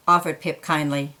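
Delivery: plain reading voice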